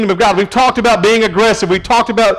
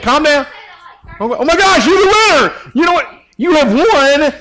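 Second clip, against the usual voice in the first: high, hammed-up game-show amazement; mock excitement; 9 semitones higher than usual. Pastor teasing a listener who got the answer, mock excitement. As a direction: gush as if they just won a big prize.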